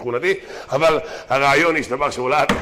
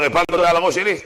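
loud, higher-pitched complaint; mock indignant husband; 6 semitones higher, 6 dB louder than usual. Husband to his wife's old flame, comically indignant. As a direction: protest loudly, played for laughs.

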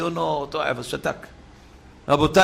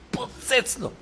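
much softer, pitched up, for effect; hushed emphasis; 4 semitones higher, 10 dB quieter than usual. Real, not acted. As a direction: drop to a high, hushed echo. Dramatic repeat in a told story.

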